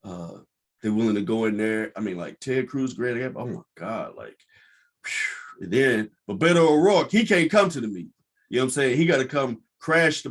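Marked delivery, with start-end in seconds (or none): none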